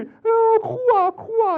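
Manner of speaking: hammed-up wail, high and loud; mock weepy viewers with an overdone wail; mock crying. Preacher mocking viewers who cry over TV dramas with a hammed-up wail.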